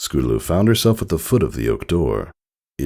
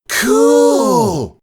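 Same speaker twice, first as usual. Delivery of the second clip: excited cheer; 6 dB louder than usual. A narrator's reading. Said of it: "the others replied in unison".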